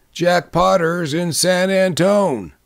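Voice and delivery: high, drawn-out group interruption; interrupting in chorus. A narrator's reading. Other men interrupting in chorus, emphatic. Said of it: "in chorus the other men interrupted".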